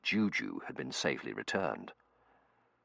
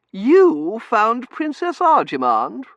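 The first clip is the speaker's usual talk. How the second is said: haughty lady voice; 14 dB louder, much slower than usual. Acted. Mrs Meering character voice.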